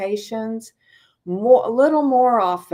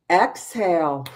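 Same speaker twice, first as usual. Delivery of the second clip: guided breathing. Acted. Teacher leading the class in breathing, calm guided cues. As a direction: guide the group gently through each breath.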